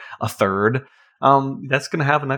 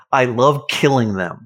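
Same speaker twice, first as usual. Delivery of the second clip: ordinary delivery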